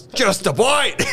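high, slow, hammed-up shark line; Bruce voice. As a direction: stretch it out like a cartoon shark. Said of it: "He goes".

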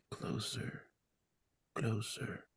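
breathy whispered beckon. Retold or imitated. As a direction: whisper it, barely audible. Dying man beckoning.

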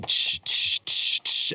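unvoiced "tsh" percussion noises; imitated cymbal hits. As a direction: mimic crashing cymbals with your mouth. Imitating the first song starting.

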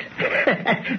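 breathy laugh. Acted. Father scoffs at the legend.

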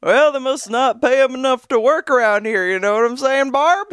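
put-on loud, passive-aggressive old lady; grumpy old person. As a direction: complain loudly so everyone hears. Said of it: "little side comments like".

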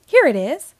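loud, high exclamation; excited discovery. A narrator's reading. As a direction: burst out with the find. Boy finds what he wanted.

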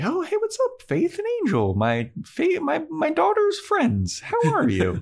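high sugary mom voice; Joyce imitation. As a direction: play a chirpy overly polite mom at the door. Joyce greeting at door.

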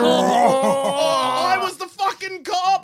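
high pained yell; bleeding out. Acted. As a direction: shout it, wounded and panicked. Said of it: "sample your lines from Reservoir Dogs".

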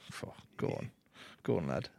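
soft, low, under-the-breath egging on; secret encouragement. Retold or imitated. Dad secretly cheering his cheeky son on, quiet encouragement. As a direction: murmur it like a private cheer.

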